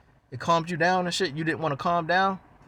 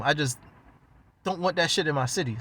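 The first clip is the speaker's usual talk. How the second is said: ordinary talk, nothing marked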